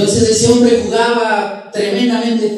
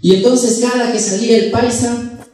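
ordinary talk, nothing marked

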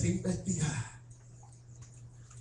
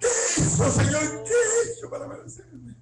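high, whining hammed-up sob; mock tearful lament; 7 semitones higher than usual. Congregant complaining to God of losses, mock tearful lament. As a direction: pile up the woes in a high fake cry. Mimics self-pitying altar crier.

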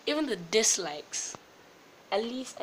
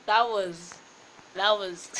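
laughing; 9 dB louder, slower than usual. Real, not acted. Laughing after failure.

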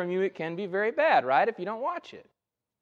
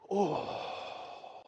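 long soft exhale into a breathy oh; 11 dB quieter than usual. Retold or imitated. Son imitating his pinched dad, slow relieved exhale. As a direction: blow the pain out slowly. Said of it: "he screamed and then he goes".

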